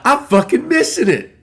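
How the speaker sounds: frustrated outburst. Retold or imitated. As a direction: blurt it out, fed up at missing the moment. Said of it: "I get all pissed".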